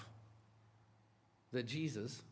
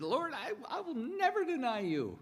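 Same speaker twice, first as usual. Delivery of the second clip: high, earnest, half-laughing protest; pleading Peter; 12 semitones higher than usual. Retold or imitated. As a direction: play Peter swearing loyalty. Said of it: "Peter said".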